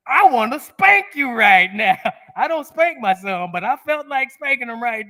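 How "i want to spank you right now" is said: angry thought. Retold or imitated. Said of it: "My thinking was".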